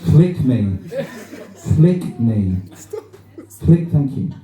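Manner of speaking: hammed-up pop-up ad pleading to be clicked. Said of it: "appear like this and be like".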